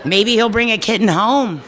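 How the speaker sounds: put-on retelling her childhood thought about kittens with a laugh; innocent child. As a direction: play the puzzled little girl. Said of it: "And I would think".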